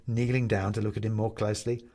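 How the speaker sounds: ordinary delivery, nothing marked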